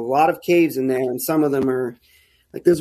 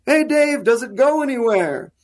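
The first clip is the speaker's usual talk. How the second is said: higher-pitched mock holler; retold yell; 8 semitones higher than usual. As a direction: imitate calling down into a hole. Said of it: "and he's yelling".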